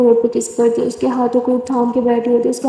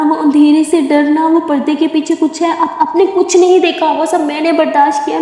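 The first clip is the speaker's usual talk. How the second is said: emotional pleading; 3 semitones higher than usual. Acted. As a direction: plead hard with the angry mother. Simran arguing with Durga's mom.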